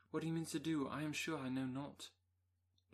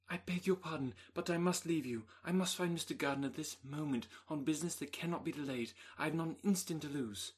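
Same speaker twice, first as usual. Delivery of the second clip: urgent, loud and rushed; hasty exclamation; 6 dB louder than usual. Acted. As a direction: blurt it out, desperate to leave. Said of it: "hastily exclaimed".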